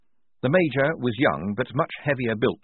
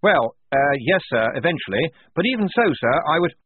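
flustered lieutenant; 6 semitones higher, 5 dB louder than usual. Acted. Blaus flustered.

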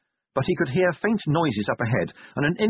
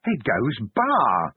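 slow, tearful storybook voice; weeping; much slower than usual. A narrator's reading. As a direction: weep it out like a child's picture book. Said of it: "it wept".

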